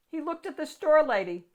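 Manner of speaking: ordinary delivery, nothing marked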